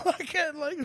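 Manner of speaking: high squealing laugh through the words. Real, not acted. Laughing at being drunk and sad at two in the morning. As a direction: break up laughing at yourself.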